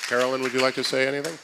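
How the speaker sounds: calling out. Real, not acted. Calling a colleague across room.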